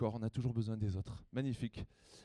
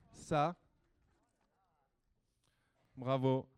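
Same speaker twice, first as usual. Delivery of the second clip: ordinary talk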